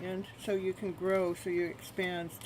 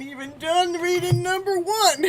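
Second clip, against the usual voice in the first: high, loud, laughing; real amused outburst; laughing exclamation; 10 semitones higher, 11 dB louder than usual. Real, not acted. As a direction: blurt it out through laughter. Laughing at her busy night.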